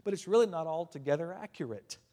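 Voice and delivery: ordinary talk, nothing marked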